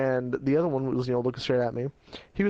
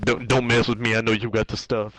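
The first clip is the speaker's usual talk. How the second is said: low, louder threatening voice; menacing thug; 4 semitones lower, 4 dB louder than usual. Retold or imitated. Sketchy man threatening the kid, low menacing voice. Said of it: "He's like".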